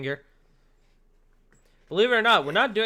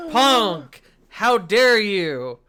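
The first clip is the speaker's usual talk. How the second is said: mock outrage; 4 semitones higher, 7 dB louder, much slower than usual.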